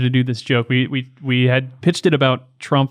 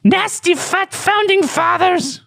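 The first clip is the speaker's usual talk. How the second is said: very high, slow hissing creature; Gollum voice; slower than usual. Acted. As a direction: sneer it like a jealous creature.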